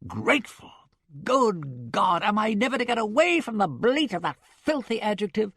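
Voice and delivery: fed up, spit out the word Grateful; a man whose patience has snapped; exasperated outburst. Acted. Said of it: "All endurance has its limits".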